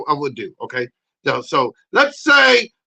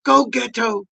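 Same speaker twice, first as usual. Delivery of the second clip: ordinary delivery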